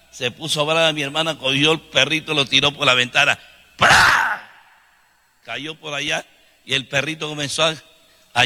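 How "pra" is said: sharp, high "pra!" burst; one loud sudden impact noise; imitated crash sound. Preacher mimics the puppy thrown out the window, imitated crash.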